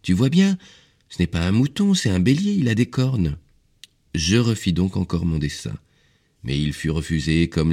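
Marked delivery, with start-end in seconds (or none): 0.0-3.4 put-on high child-like voice, gently correcting; pitched-up, indulgent child; indulgent little prince. A narrator's reading. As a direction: explain patiently as a small boy. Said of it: "sourit gentiment avec indulgence".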